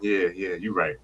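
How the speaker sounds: soft, low, deflated giving in; meek concession. Retold or imitated. Sam quietly giving in to Sharon, soft deflated concession. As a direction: fold quietly, beaten.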